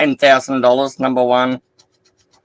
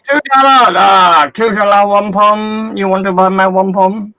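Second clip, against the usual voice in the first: much higher, louder, slowed hawker voice; hammed-up vendor pushing wares, pitched way up; mock street hawker; 6 semitones higher, 6 dB louder than usual. Streamer jokingly sells his scratchies to chat, loud high mock hawker.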